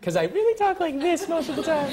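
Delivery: very high, put-on voice; weird high voice. Actor jokes to host about his weird accent, mock high voice. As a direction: pitch it absurdly high, as a joke. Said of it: "my weird high accent".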